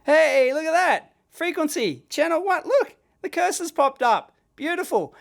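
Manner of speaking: real thrill as the test comes off; delighted. Real, not acted. Tester delighted his voice command worked, excited.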